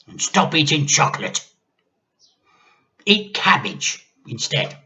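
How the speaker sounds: put-on an old woman barking orders; grandma ordering the boy about; bossy grandma. A narrator's reading.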